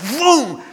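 sudden high "boom" of a car; imitated zoom. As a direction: shoot it out like a car zipping past. Imitates car darting over suddenly.

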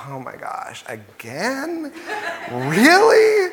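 incredulous, half-laughing groan rising to a high squeak on Really; exasperated eye-roll. Retold or imitated. Reading the Israelites' story, rolling his eyes in exasperation. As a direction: roll your eyes at them, laughing in disbelief. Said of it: "just rolling my eyes going".

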